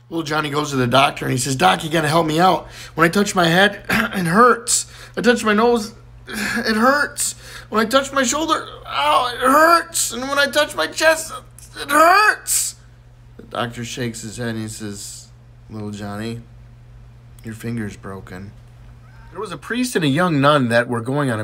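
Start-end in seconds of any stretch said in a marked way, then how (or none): 1.6-12.8 mock-pained whine climbing higher and higher; whining hurt child. Boy complains of pain, escalating. 15.7-18.5 low, hushed diagnosis; deadpan doctor. Acted. Doctor's deadpan diagnosis after whining.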